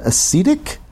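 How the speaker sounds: "ascetic" with a long E, as uh-SEE-tic, questioning. Real, not acted. Host unsure of a word, "ascetic" said uh-SEE-tic. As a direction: ask "ascetic" with SEE in the middle. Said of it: "Not ascetic".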